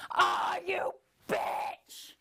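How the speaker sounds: slow, high, breathy shriek of rage; screeching curse. Acted. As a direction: spit the curse like a demon. Uterus curses her.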